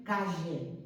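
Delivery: "calle" said KA-sheh, slowly; "calle" with LL as a hushing sound; Rioplatense SH sound. Shown as a demonstration. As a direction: say "calle" with the Argentine SH for LL. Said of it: "Hacen como una S H".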